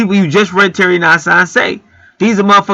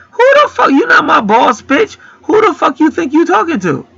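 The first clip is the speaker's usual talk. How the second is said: retorted outrage; 12 semitones higher than usual. Retold or imitated. Angry retort to the customer.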